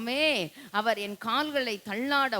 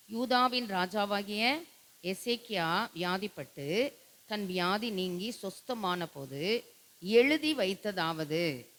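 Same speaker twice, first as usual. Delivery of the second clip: slow, patient scripture reading. Shown as a demonstration. Pastor shows the congregation how to read, slowly. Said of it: "நல்ல பொறுமையா வாசிக்கணும்".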